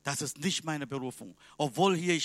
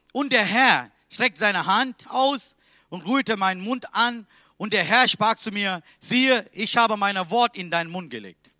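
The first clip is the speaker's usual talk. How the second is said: solemn scripture reading; 4 semitones higher, 8 dB louder than usual. Acted. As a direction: proclaim the verse to the congregation. Reads the Bible verse aloud. (feierliche Bibellesung, laut und langsam)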